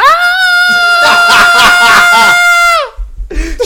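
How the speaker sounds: high, loud shriek of laughter; loud screaming laugh; shrill scream-laugh. Real, not acted. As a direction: let out a piercing scream. Scream after being called [name].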